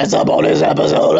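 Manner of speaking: goofy deep shout. Acted. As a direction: boom it out in a silly deep voice. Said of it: "getting loosey-goosey and goofy".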